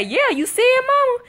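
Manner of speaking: put-on high, loud, eager kid voice; imitated son. Son shows mama his underarm, eager. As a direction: ask like an excited boy showing off. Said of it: "He say".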